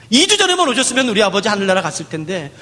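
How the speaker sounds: normal talking voice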